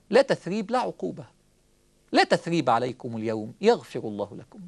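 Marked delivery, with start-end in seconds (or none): 2.1-4.6 lower, measured recitation of the verse; Quranic recitation. Acted. Preacher quoting Yusuf to his brothers, low recitation.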